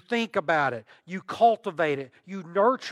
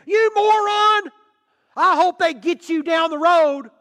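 angry yell; 11 semitones higher, 12 dB louder than usual. Retold or imitated. Driver screaming at tailgaters, furious high shout. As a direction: bellow it at the speeding drivers.

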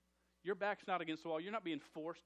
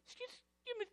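tiny high-pitched timid voice, much softer; timid squeak; 4 dB quieter than usual. Retold or imitated. Pastor imitating his assistant trying to get a worker's attention, tiny timid squeak. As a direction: squeak it out like a shy girl afraid to interrupt. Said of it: "and she's like".

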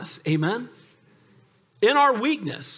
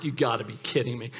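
lower, softer aside; deadpan inner thought; muttered disbelief; 9 semitones lower, 6 dB quieter than usual. Retold or imitated. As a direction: mutter it under your breath. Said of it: "I'm like".

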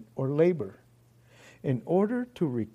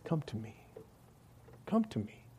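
gentle plea; 14 dB quieter than usual. Acted. Jesus's gentle invitation voiced.

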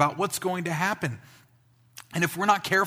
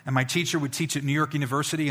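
normal talking voice

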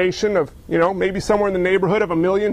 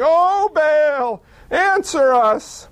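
higher, slow pleading call to Baal; prophets crying out; 6 semitones higher, much slower than usual. A narrator's reading. Prophets of Baal pleading slowly to their silent god. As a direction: stretch the call out like a desperate prayer.

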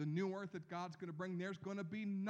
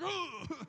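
exaggerated groan; 11 semitones higher, 8 dB louder than usual. Grandparent reacting to grandkids.

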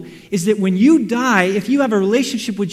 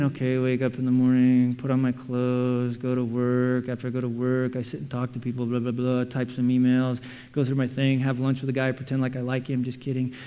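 bored routine; 8 semitones lower, 6 dB quieter than usual. Retold or imitated. Mocking a dull daily routine.

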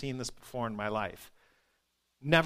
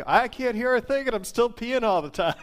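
laughing retelling; 7 semitones higher, 5 dB louder than usual. Retold or imitated. Patient's reply retold through laughter.

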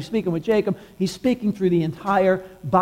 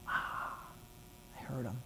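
barely voiced, very soft; hushed awe; 6 semitones lower, 22 dB quieter than usual. Elijah realising he has heard God, in a tiny hushed voice. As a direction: drop to almost nothing on a soft sigh. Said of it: "in the still small voice".

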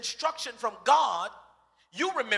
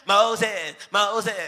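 louder whining call of the name; nagging crowd; 6 dB louder than usual. Retold or imitated. Israelites pestering Moses, loud whiny call. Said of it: "that's how they talk".

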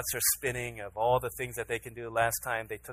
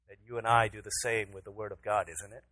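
ordinary delivery

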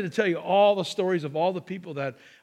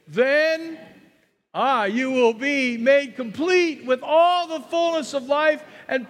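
higher, slow, fervent scripture reading; best ten eleven voice; 5 semitones higher, 3 dB louder than usual. Acted. Pastor leading the congregation's scripture reading, higher and slow. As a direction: read it out big and stretched like a proclamation. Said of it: "read it with our best ten eleven voice".

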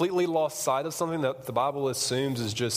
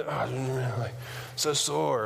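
groaning sore lifter; sore gym bro; 2 semitones lower, much slower than usual. Retold or imitated. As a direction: moan about soreness, lightly voiced. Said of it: "When somebody comes".

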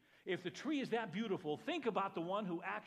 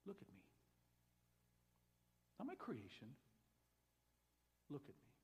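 much softer, gentle plea to look; soft call; 10 dB quieter than usual. Acted. God gently calling every listener: much softer, tender. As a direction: speak it softly like a quiet invitation.

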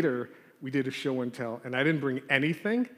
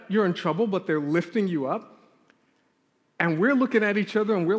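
normal talking voice

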